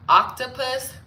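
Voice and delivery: usual speaking voice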